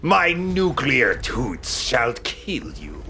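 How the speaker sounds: slow, hammed-up villain voice; mock villain threat. Gamer mocking the boss's threat in a slow, hammy villain voice. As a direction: drag it out like a cartoon baddie.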